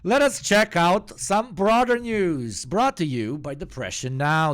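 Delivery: hamming up the show's sponsor read; mock announcer. Streamer parodying the news intro, booming mock announcer.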